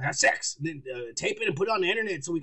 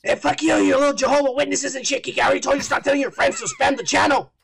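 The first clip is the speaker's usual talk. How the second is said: loud scolding; 6 semitones higher, 5 dB louder than usual. Real, not acted. Mock scolding co-host.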